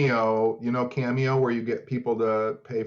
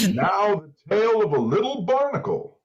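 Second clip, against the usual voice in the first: mock narrator voice; 7 semitones higher than usual. Mock narrator telling a tale.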